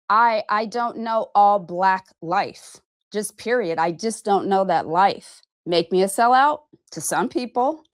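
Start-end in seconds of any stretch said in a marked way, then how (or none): none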